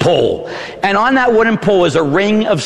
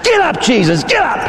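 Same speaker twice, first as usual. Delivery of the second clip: high, jumping higher on the repeat; acted harsh command; 9 semitones higher than usual. Soldier standing over fallen Jesus before kicking, high harsh command. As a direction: order the fallen prisoner up.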